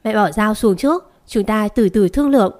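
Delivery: ordinary delivery, nothing marked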